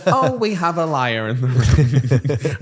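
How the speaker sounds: slow, loud, laughing teacher busting liars; mock accusation. Teacher to pupils faking broken pens, mock accusing. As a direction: savour catching them out with a laugh.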